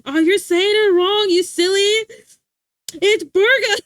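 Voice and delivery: loud, high-pitched roast, burger ending in uh; teasing friend. Retold or imitated. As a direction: imitate a friend gleefully correcting pronunciation. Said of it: "She would always be like".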